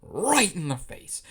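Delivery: RIGHT drawn out, swooping high and loud; dramatic emphasis. A narrator's reading. Narrator relishing the slap, emphatic on "right". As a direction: stretch and punch "right".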